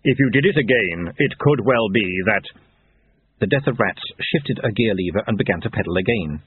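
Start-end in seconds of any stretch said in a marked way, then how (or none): 0.0-2.5 grim reaper voice. Acted. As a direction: speak as the hollow-voiced Death. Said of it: "said Death".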